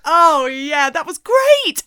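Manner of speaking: loud high praise; excited. Real, not acted. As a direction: gush over the take. Praising the take.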